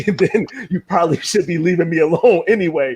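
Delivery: high laughing aside. Real, not acted. Host joking with viewers about old habits, laughing. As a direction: chuckle through the joke.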